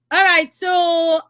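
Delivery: ordinary talk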